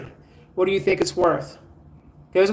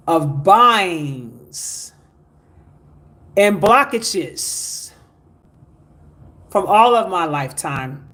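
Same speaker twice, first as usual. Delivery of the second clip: prayer leading; much slower than usual. Shown as a demonstration. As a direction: intone each phrase slowly for them to repeat. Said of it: "repeat these words after me".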